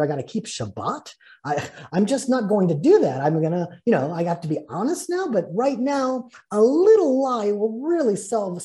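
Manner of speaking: insistent excuses, swinging up high on key words; mock self-justifying. Preacher voicing someone dodging the covenant, mock self-justifying. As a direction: argue your way out, hammed-up and emphatic.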